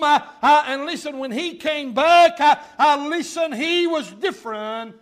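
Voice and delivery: loud, driving pulpit delivery; preaching. Real, not acted. Preaches at full force.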